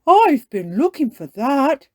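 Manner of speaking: loud, lifted, pleased character voice; Daddy Pig. Acted. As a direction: exclaim it as a delighted cartoon dad. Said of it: "says Daddy Pig".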